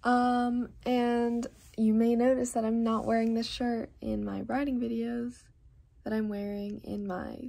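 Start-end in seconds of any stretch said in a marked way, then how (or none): none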